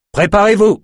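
high, forceful shout; rally the troops for a fight; shouted command, itching to fight; eager battle cry. A narrator's reading. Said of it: "cria Zimmer, bouillant d'en découdre".

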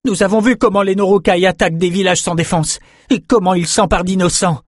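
high, loud and agitated. A narrator's reading. Nietzsche denounces the Norokai raids, angry and loud. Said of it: "Très énervé, il parla plus fort".

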